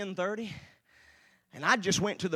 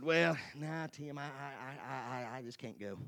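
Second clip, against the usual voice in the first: low, soft stammering excuse; hesitant refusal; 4 semitones lower, 9 dB quieter than usual. Retold or imitated. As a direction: back out feebly, voice sinking away. Retelling his hesitant refusal.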